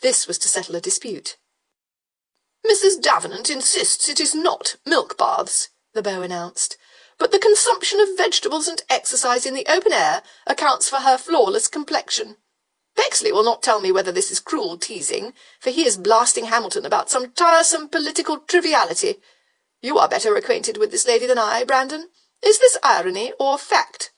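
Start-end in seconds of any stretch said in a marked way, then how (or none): 2.6-5.7 higher, louder, slow dandy voice; foppish beau. Acted. Beau reporting gossip to Brandon, affected high voice. 7.2-24.1 foppish beau. Acted. Beau reporting gossip to Brandon, affected high voice.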